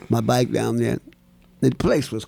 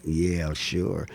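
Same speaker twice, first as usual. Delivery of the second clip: skeptical; 7 semitones lower, 8 dB quieter than usual. Retold or imitated. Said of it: "I'm looking at him like".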